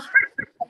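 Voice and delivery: a high-pitched burst of giggling; high hysterical giggle. Retold or imitated. Retelling getting high, a high hysterical giggle. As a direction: let a giggle fly up high. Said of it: "I start laughing hysterically".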